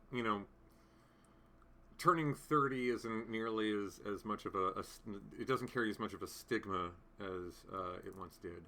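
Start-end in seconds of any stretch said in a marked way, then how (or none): none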